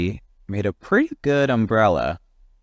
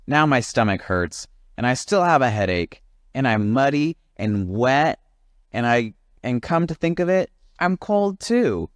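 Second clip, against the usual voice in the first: climbing higher, whinier list; whiny complaining; 7 semitones higher than usual. Acted. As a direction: pile on the complaints. Jim listing woes.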